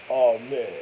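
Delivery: ordinary talk, nothing marked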